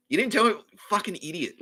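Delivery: mock exasperated rebuke; exasperated scolding. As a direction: imitate a lawyer berating the client. Her lawyer scolds her, acted.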